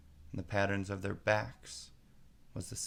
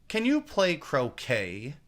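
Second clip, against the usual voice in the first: slow, higher, louder; queen calling out; 6 semitones higher, 7 dB louder, slower than usual. A narrator's reading. Queen calls Alice to croquet. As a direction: hail her loudly.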